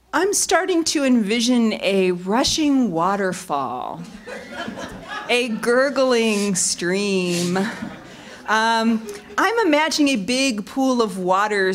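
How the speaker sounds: slow, dreamy guided-imagery voice; mock dreamy visualizing. Colleague mock-meditating to tease her before airtime, slow. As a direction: paint the waterfall slowly, teasing. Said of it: "he'll say".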